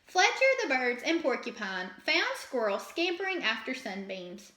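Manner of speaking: ordinary delivery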